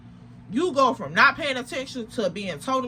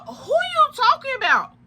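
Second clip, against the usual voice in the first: much higher, pointed demand; indignant mother asking who is meant; mama's sharp question; 12 semitones higher, 3 dB louder than usual. Retold or imitated. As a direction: snap the question up high like an annoyed mom.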